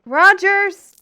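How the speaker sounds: loud, high call of the name. Acted. Calling Rogers again, who is hidden somewhere, loud shout. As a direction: shout the name across the room.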